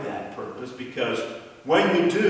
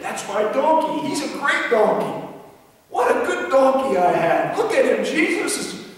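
much higher and louder, bursting with pride; so excited; 11 semitones higher, 7 dB louder than usual. Acted. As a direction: brag to the man beside him. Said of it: "He was so excited".

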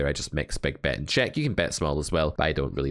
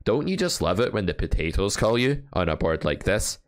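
ordinary delivery

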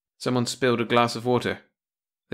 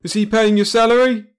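roaring; 12 semitones higher, 9 dB louder than usual. A narrator's reading. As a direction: roar down the objection. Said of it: "roared Brad".

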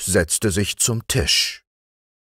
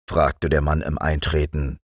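usual speaking voice

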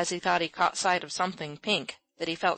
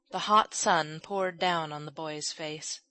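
plain reading voice

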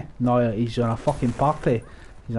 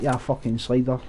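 ordinary talk

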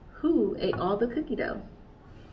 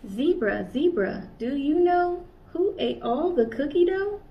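higher, louder questioning call; curious kangaroo asking; 6 semitones higher, 4 dB louder than usual. Acted. Kanga asking each animal.

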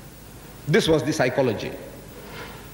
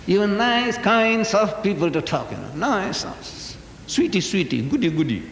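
mocking sweetness; 4 semitones higher than usual.

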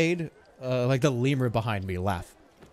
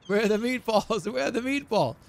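Gordon Ramsay impression; 7 semitones higher than usual. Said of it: "Gordon Ramsay?".

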